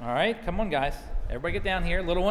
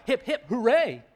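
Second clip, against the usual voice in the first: raised, mildly loud storybook cheer; cheering shout; 5 semitones higher, 5 dB louder than usual. A narrator's reading. Poem reader cheering Ruth's words, playful half shout. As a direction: lift into a playful hooray. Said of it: "make you shout".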